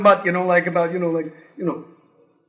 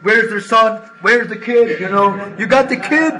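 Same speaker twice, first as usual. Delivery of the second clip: policeman demanding; 2 semitones higher, 5 dB louder than usual. Retold or imitated.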